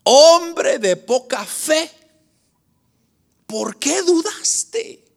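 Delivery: slow, high, drawn-out reproach; Cristo. Retold or imitated. Christ rebukes the doubting disciple, slow and high. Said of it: "lo primero que le dice Cristo".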